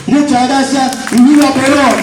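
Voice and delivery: loud rallying cry; booming balcony speech. Real, not acted. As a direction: shout it to the crowd. Rally cheer closing speech.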